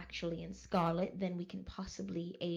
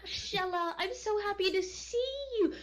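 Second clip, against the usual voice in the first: high, bright-voiced gush of delight; gushing welcome; 10 semitones higher, 3 dB louder than usual. [name] greeting Shella with high-pitched gushing delight. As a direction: squeal her name like a dear friend.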